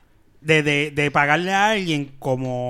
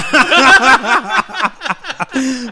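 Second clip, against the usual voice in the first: laughing; 7 semitones higher, 6 dB louder than usual. Real, not acted. Laughs at the imitation.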